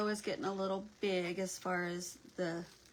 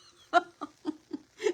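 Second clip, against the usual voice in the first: loud, high burst of laughter; laughing; 10 semitones higher, 10 dB louder than usual. Real, not acted. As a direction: burst out laughing at a viewer's confession. Amused by viewer's hiding confession.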